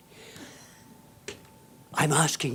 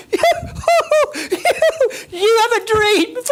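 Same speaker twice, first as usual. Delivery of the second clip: mocking laugh; 9 dB louder than usual.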